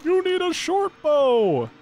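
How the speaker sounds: high, drawn-out mock yell; hammed-up high holler. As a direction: imitate the game yelling orders, slow and high-pitched. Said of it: "is yelling at me right now".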